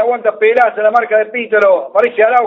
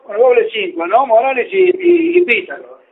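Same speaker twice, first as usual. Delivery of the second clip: usual speaking voice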